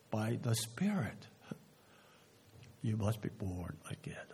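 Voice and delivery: low, soft, sinking toward a whisper; hushed solemn answer. Retold or imitated. Preacher giving Jesus' answer to Nicodemus, hushed. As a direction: drop the voice low for the quoted answer.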